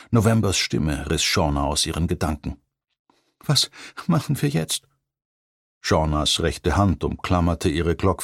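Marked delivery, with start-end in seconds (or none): 3.4-4.9 put-on much higher, frightened girlish voice; a timid young woman asking what now; scared young woman. A narrator's reading.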